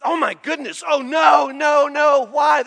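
hammed-up panicked cry; mock panic. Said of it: "your first instinct is often just to panic".

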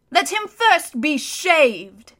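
slow, high, loud cruel witch voice; gloating witch. Acted. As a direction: savour the humiliation.

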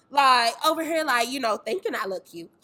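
usual speaking voice

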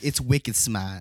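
drawn-out Boston slang quote; Boston accent. Retold or imitated. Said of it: "all this Boston slang".

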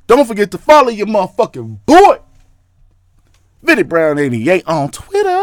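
loud, high sign-off shout; hyped-up plug. Pretend. Host plugging Twitter to listeners, hyped-up and loud. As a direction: belt out the plug, stretching the endings.

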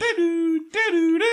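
high, loud robot tune; robot computer noises. Pretend. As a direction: hum like a whirring computer, pitched high. Robot noises while GM searches.

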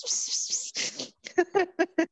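whispered psst, last one high; kitty-calling psst. Pretend. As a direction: call a cat with a hiss. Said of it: "you wouldn't wanna go up to and go Ps".

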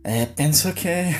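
usual speaking voice

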